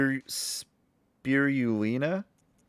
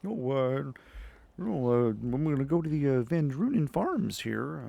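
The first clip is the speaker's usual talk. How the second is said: bad Fozzie Bear; 4 dB quieter than usual. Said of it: "I can't do Fozzie Bear".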